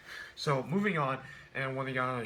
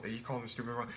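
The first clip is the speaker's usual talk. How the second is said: normal talking voice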